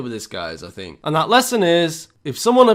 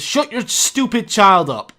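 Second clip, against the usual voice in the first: higher, louder, slow hostile demand; retold angry shout; 6 semitones higher, 6 dB louder than usual. Dog owner shouting at the mother on the bus, slow angry yell. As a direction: bark it at her over the screaming baby. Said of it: "the guy with the dog says".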